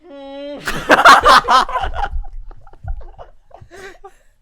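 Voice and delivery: high trumpeting elephant call. Pretend. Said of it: "Become the elephant".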